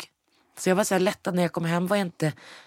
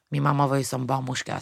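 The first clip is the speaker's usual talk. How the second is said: ordinary talk, nothing marked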